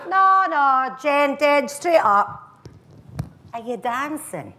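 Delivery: high, insistent Glaswegian lad; Glaswegian accent. Acted. Guy insists she dance, high Glaswegian accent. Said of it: "I go into the accent".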